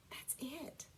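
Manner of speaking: soft whispered aside. Real, not acted. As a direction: breathe it out as a whisper. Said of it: "whispered aside".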